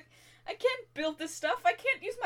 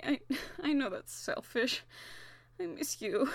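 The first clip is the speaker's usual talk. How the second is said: ordinary talk, nothing marked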